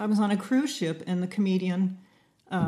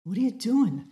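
teasing catchphrase impression, a little raised; playful mimicry of a friend; imitating the co-host; 3 semitones higher than usual. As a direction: mimic your friend's stock question. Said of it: "if I was doing you, I'd say".

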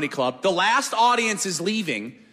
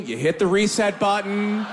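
normal talking voice

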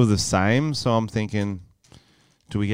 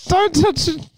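prank reveal laughing; 13 semitones higher than usual. Real, not acted. Reveals his undies prank.

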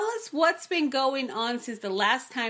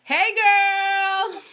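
excited greeting; 11 semitones higher, 9 dB louder than usual. Real, not acted.

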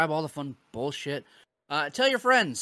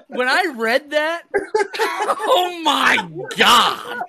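high, loud laughing shout; laughing disbelief; 9 dB louder, much slower than usual. Real, not acted. Host cracking up over the killer quote, laughing shout. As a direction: cry it out through helpless laughter. Cracks up recalling what he read.

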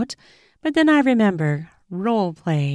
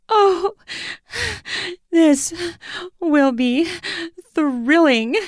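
high gasp then higher, panting words; aroused woman gasping, much higher; breathless; 11 semitones higher, slower than usual. A narrator's reading. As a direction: pant the words out between gasps. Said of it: "breathlessly between pants".

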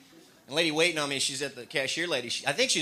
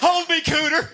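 high, loud mock panic; hammed-up scared cry to Cooter; mock fright; 12 semitones higher, 8 dB louder than usual. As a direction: clutch your friend in fake terror.

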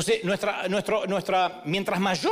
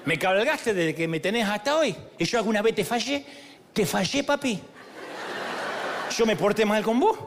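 put-on Argentine accent. The donkey answers Balaam in a mock Argentine accent. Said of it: "distinguido acento argentino".

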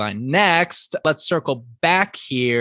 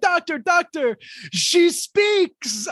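much higher, excited Frenchie voice; excited imitation; slower than usual. Frenchie runs out calling the doctor, high excited voice. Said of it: "He's like".

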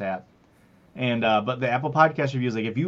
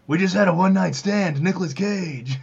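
mock Nicolas Cage; 6 semitones higher, 4 dB louder than usual. Cohost joins Cage impression.

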